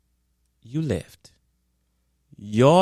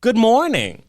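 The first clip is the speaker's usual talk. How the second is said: cheery greeting; 8 semitones higher, 3 dB louder, slower than usual. Shown as a demonstration. Demonstrates greeting she expects.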